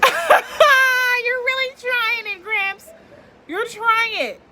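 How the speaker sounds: very high, loud, drawn-out call at the neighbor; 1 exasperated high shout. Real, not acted. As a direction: yell at Gramps over the noise. Shouting at grandpa with weed whacker.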